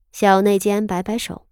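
ordinary delivery

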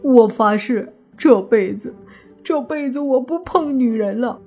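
tearful oath climbing into a high wail; crying vow. A narrator's reading. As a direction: sob out a pitiful, halting vow. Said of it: "就听慕云逸还哭着呢". (哭着委屈地发誓)

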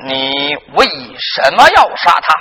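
low, slow, stately interrogation; presiding magistrate. Acted. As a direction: draw the question out heavily from the bench. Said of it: "你爹往堂上一坐". (官老爷低沉缓慢审问)

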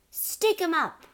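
high, loud challenge; yelled. A narrator's reading. Matilda bursts in on burglars, yelling. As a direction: yell it to catch them out. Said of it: "she yelled".

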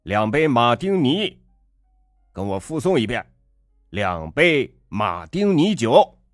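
taunting. A narrator's reading. Father taunts the slow waiter.

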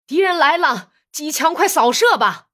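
loud, high alarm yell while running; shouting. Acted. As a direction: scream the warning at full voice. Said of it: "还喊叫了一声".

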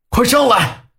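high, loud call to the crowd; loud shout. A narrator's reading. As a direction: yell it up the bank. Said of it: "我冲着人群大喊了一声".

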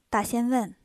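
ordinary delivery

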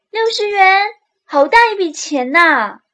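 high, loud delighted cry; thrilled at the money; excited mother. Retold or imitated. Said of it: "妈妈可能会这么说".

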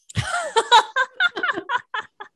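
high, bright peal of laughter at the silly ending; burst of laughter. Real, not acted. (高声大笑)

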